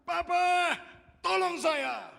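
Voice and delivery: full-force high scream for papa; very loud child's cry for help. Retold or imitated. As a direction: shout desperately for rescue. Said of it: "tiba-tiba dia berteriak".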